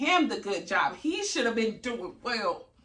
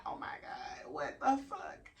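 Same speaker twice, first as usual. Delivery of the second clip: hushed, imitated worried whisper; scared; 6 semitones higher, 7 dB quieter, slower than usual. New mother hearing no cry, scared hushed reaction. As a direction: say it under your breath, alarmed. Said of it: "so of course you be scared".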